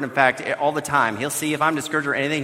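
ordinary talk

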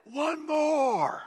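high, drawn-out trainer call; trainer's demand. Retold or imitated. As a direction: imitate a trainer calling out a dreaded order. Trainer demands another rep.

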